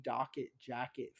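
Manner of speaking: normal talking voice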